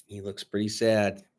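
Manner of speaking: plain reading voice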